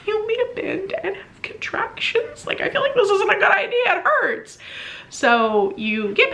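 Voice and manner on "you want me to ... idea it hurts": high, strained mock whimper; pained protest. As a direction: plead through contraction pain, half laughing. Acting out bending during contractions.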